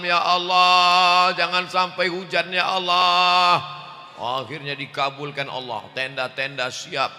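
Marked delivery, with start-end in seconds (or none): 0.0-3.6 loud, drawn-out pleading cry to God; fervent prayer. Retold or imitated. Committee praying the night before, loud and drawn out. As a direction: stretch out the Ya Allah like a desperate plea.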